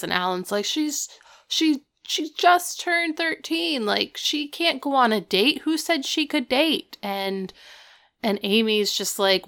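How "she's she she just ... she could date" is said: worried dad voice. Retold or imitated. Alan acted as indignant father.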